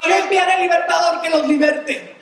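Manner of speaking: high, sustained prophetic proclamation; fervent preaching. Acted. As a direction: proclaim scripture to a whole congregation. Preaches prophecy at full force.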